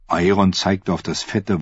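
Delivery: usual speaking voice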